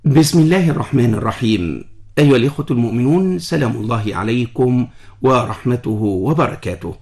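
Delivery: formal radio host greeting; formal standard arabic. Acted. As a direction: open the show like a broadcaster. Host's formal opening greeting.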